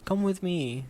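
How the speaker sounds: a dead friend's uncanny plea; pleading. Acted. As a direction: beg him to come along. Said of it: "he pleaded".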